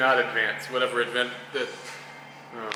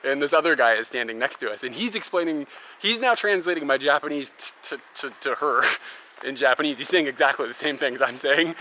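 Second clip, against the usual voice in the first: usual speaking voice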